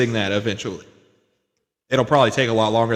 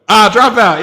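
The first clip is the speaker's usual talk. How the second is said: mock shout; 8 semitones higher, 8 dB louder than usual. Real, not acted. Loud echo.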